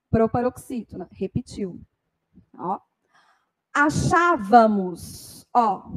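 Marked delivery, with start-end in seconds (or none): 3.7-5.5 "Achávamos" drawn out slowly, leaning on "chá"; slow stressed demo. Shown as a demonstration. Teacher counts syllables for students, drawing out "chá". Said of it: "Um, dois, três".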